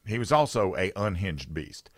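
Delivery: usual speaking voice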